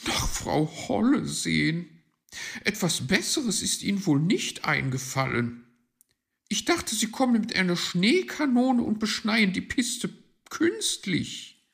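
high, loud complaining magpie; disappointed magpie. Acted. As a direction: scoff in a high, loud character voice.

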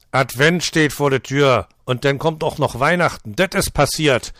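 angry burst. Acted. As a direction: spit it out in anger. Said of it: "stößt Otto erbost hervor". (wütend hervorgestoßen)

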